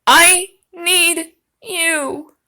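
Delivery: very slow, drawn-out mock plea; mock pleading. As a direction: stretch it out like a lovesick plea, hammed up.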